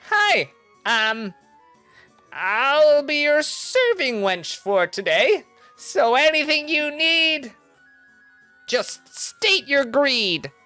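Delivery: high, slow sassy barmaid voice. Acted. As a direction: drawl it out like a cheeky tavern wench. GM plays the serving wench.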